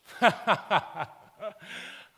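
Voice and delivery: loud, high laugh at her misery; mock hearty guffaw; put-on laugh. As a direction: laugh heartily, as if mocking the tragedy. Preacher's joking response to child's meltdown.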